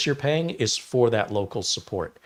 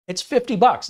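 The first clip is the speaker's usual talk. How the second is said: much higher, louder, fed-up answer; retold exasperation, pitched up high and loud; exasperated seller; 12 semitones higher, 5 dB louder than usual. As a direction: snap it back like you've answered this already. Seller exasperated by buyer questions.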